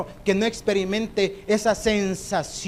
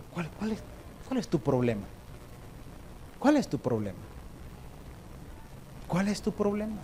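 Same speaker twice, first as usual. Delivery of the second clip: hushed question; 11 dB quieter than usual. Acted. Repeated question dropped low.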